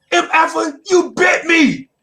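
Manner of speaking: high, loud, slow outraged cry; outraged eagle yell. Acted. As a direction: shriek it at the snake that just bit you. Eagle bitten, crashing into river.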